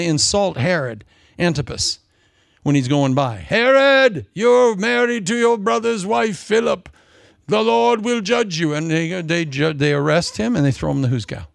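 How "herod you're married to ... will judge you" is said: prophet rebuke. Retold or imitated. John rebuking Herod.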